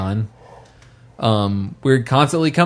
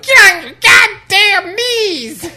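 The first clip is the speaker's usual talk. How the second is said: very high, slow, loud squeaky yell; a pint-sized brute shrieking threats; tiny giant voice; 7 dB louder, much slower than usual. Retold or imitated. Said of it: "midget versions of that going like".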